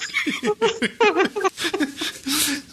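long high giggle; high laugh. Real, not acted. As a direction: giggle freely. Laughing about editing.

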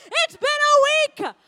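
high, loud and slow mock outrage; shrieking it's been a week; exasperated outburst. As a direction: throw up your hands at a week-old dish. Exasperated about old leftovers.

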